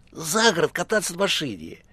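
high, put-on caller voice; eager high phone voice; crying out. A narrator's reading. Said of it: "кричала трубка".

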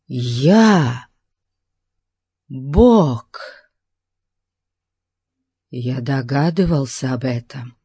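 slow, measured, lightly voiced ruler; self-deified tyrant, awed. A narrator's reading. Tyrant declares himself god, slow solemn revelation. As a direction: proclaim it slowly, with drawn-out weight on the titles.

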